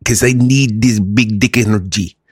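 eager Igor with a put-on Russian accent. Igor begs to know how to get this, Russian accent. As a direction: play a needy Russian spa-goer, accent on. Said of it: "the Russian accent".

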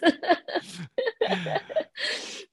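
high, breathy laugh through tears; teary laugh. Real, not acted. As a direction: laugh as the crying begins. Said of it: "I told you I would cry".

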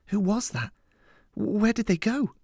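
put-on anxious girl. A narrator's reading. Lillian questions her mentor after the attack, anxious. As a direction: ask urgently in a high girlish voice.

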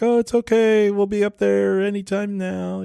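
put-on high falsetto mom call, reassuring the baby. Mother calls up to her baby on the boat, high mock reassuring. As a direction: call up high and sweet like a fake-calm mom. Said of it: "kinda yelling to the baby".

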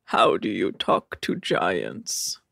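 slow, low deadpan riddle; Rob. Retold or imitated. Host as Rob telling Nick a joke, deadpan. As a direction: pose it in a stiff, dragging monotone. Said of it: "What's that, Rob?".

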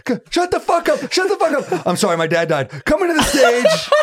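grieving owner losing it on the mic; screaming owner. Retold or imitated. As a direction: yell it, blurt the apology, yell again. Owner yelling then apologizing.

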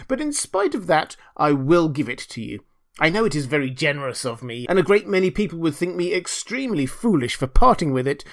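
pompous, measured declaration; self-satisfied miller. Acted. Miller promising Hans his wheelbarrow, smugly magnanimous. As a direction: bestow it like a grand favour.

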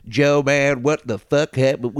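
Southern-accented impression of a buddy; Theo Vaughn. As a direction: lightly imitate a comic's Southern drawl. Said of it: "trying to sound like Theo".

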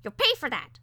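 high, angry little-bird outburst; squawking parakeet threat. A narrator's reading. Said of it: "squawked, and chattered".

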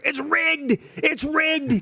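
high-pitched mock yell, drawn out; imitated grandpa's scream. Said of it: "he was screaming from his recliner".

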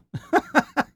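high, broken laugh; last-resort laugh. Acted. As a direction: laugh because nothing else is left. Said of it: "Wally laughed as an emotion of last resort".

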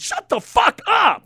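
loud, high, drawn-out yell; angry outburst at full voice; exasperated shout. Real, not acted. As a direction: shout it slowly at the tattletales. Outburst at people telling on others.

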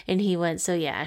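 ordinary talk

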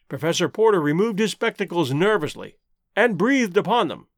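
ordinary delivery, nothing marked

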